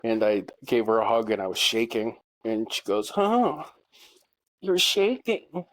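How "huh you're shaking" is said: high, drawn-out mimicry of an ex-wife; imitated ex-wife's reaction. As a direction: pitch it way up and stretch it slowly. Acts out ex-wife's reaction to him.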